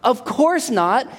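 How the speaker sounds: startled parent. Retold or imitated. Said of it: "You'd be like".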